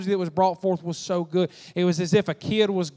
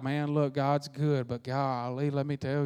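quieter, lower, a touch of mock gloom; 4 semitones lower, 7 dB quieter, slower than usual. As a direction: play the weary complainer, softly. Voicing a downcast churchgoer.

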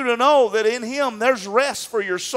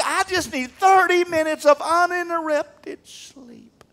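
building, higher and higher desperate plea, fading on the last word; exhausted pleading; 7 semitones higher than usual. Acted. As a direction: plead like a worn-out parent, stretching words out. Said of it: "so tired you say".

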